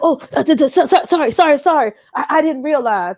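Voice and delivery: hammed-up stuttering backpedal; flustered apology. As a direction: imitate someone tripping over a panicked sorry. Man's flustered apology, retold.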